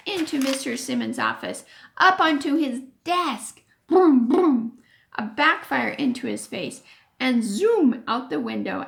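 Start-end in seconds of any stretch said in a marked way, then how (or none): 3.9-4.8 engine noise. Acted.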